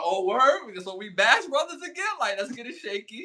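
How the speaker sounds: high-pitched eager mock excitement; hyped-up quote. Host voicing the Bash Brothers hyped to play, excited. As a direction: pitch way up, like a teammate raring to go. Voices hyped teammates reuniting.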